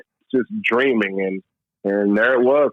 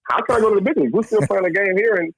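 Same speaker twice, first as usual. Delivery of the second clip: disbelief; 8 semitones higher than usual. Retold or imitated. Stunned by big-league call-up.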